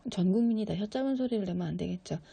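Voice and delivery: normal talking voice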